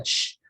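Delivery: single voiceless sh to show the consonant; hissed sh sound. Shown as a demonstration. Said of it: "é isso daqui, tá?". (sh surdo de demonstração)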